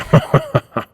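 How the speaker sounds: loud, high burst of chuckling; amused villainous laugh. Acted. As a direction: laugh wickedly at your own joke. Laughs at own self-mocking remark.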